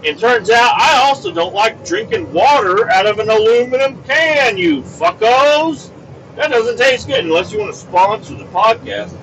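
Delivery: high-pitched, worked-up complaint; exasperated, pitch climbing high; indignant rant. Real, not acted. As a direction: tell them off in comic outrage. Comic rant against canned water.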